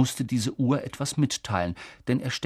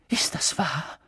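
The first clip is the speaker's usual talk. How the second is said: whispered, higher, stunned question; stammering; 6 semitones higher than usual. A narrator's reading. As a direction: ask it in a faltering whisper. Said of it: "stammelte Professor McGonagall". (stammelnd geflüstert)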